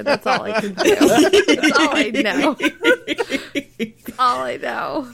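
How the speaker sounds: laughing. Real, not acted. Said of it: "Because I'm from California".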